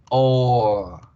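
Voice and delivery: "O" held long as a rounded ooor; lengthened vowel. Shown as a demonstration. Teacher showing vowel before R, "O" drawn out. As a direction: stretch "O" out firmly. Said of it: "el hecho de alargar la vocal".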